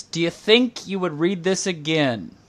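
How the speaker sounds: ordinary talk, nothing marked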